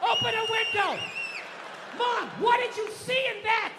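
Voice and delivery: high frantic shouting; loud alarmed cries; panicked yell. Acted. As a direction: yell at the family to get out. Acted panic, yelling at family.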